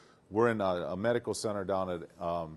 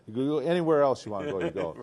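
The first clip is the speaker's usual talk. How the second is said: dad's voice; 5 semitones higher than usual. Retold or imitated. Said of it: "And he said".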